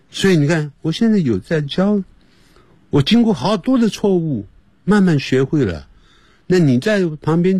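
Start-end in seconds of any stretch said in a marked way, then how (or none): none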